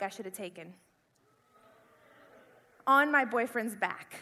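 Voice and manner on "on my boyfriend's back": ordinary delivery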